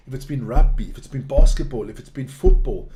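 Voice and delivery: "rugby", "basketball", "football" each punched loud; irritated list, loud stress on "rugby", "basketball", "football"; emphatic listing. Real, not acted. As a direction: hit "rugby", "basketball" and "football" hard in turn. Irritated rant listing ruined sports.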